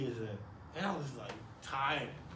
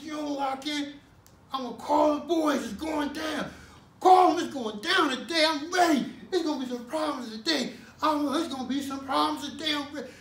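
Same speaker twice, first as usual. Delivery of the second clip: threat; 7 semitones higher, 3 dB louder than usual. Retold or imitated. Guard threatens, then he answers.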